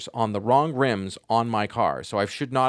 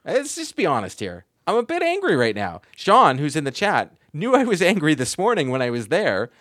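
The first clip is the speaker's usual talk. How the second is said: worked up, higher-pitched venting; real irritation rising higher and louder; angry; 6 semitones higher, 4 dB louder than usual. Real, not acted. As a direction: own up to being angry, voice climbing. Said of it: "I'm angry".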